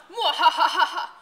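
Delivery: slow loud evil cackle; mad scientist. Acted.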